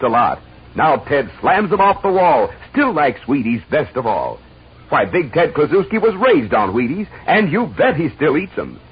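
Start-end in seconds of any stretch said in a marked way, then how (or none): none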